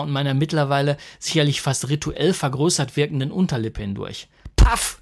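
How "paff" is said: much higher, much louder burst; shout. A narrator's reading. Husband swatting the mosquito, shouting. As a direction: yell it as you strike. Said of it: "schrie ich".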